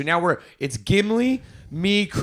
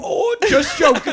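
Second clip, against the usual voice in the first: high, loud mocking singsong; mock the creep's excuse; 11 semitones higher, 6 dB louder than usual. Friends mocking the creep's fake apology.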